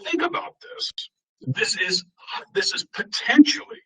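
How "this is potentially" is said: potentially spoken as the key word, pitched up; stress on potentially. Shown as a demonstration. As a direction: lean hard on the word potentially. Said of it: "I emphasize the word potentially".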